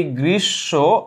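"গ্রীষ্ম" drawn out slowly, syllable by syllable. Shown as a demonstration. Teacher sounding out a word for the class, slowly. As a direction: stretch both halves of "গ্রীষ্ম".

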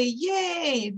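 put-on a light toddler cheer; imitating toddler. Grandmother imitating the toddler's cheer, lightly. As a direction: cheer like a baby. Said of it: "Yosef would say".

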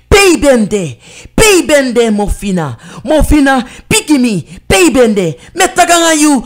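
luide, boze tirade in het Sranan; woedend schreeuwen. Acted. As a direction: scheld haar hard de huid vol. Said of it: "schreeuwde ze". (woedende moeder schreeuwt tegen Mofina)

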